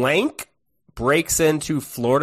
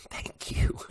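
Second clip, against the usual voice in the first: soft whispered thank you; whispering; 10 dB quieter than usual. Retold or imitated. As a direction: whisper it back.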